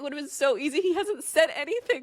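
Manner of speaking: high, loud, laughing disbelief; exasperated rant. Real, not acted. Laughing rant about terrible traitor.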